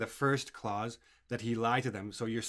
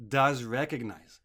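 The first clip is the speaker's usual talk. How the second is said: does recognize slower and louder, leaning on does; stress on does recognize; 5 dB louder than usual. Shown as a demonstration. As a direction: hit does recognize slowly. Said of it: "I'm emphasizing the does".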